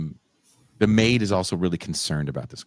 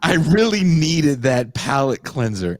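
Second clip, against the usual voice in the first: mock relief; 7 semitones higher, 5 dB louder than usual. Mock relief at romance trope.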